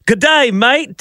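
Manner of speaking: high-pitched mock Australian greeting; imitated Aussie accent. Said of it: "with an Aussie accent".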